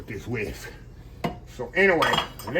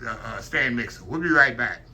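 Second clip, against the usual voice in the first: normal talking voice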